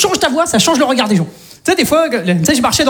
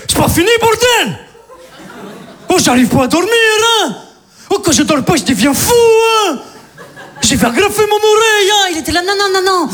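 crazy neighbour; 9 semitones higher than usual. Retold or imitated. Confronting the noisy neighbour, high manic voice. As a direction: rant like a sleepless madman. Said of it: "je fais".

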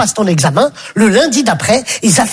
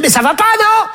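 much higher, scornful half-shout; imitating Stéphanie; 7 semitones higher than usual. Stéphanie rejecting the boy, mocking him. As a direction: brush him off with disdain. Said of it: "Elle dit".

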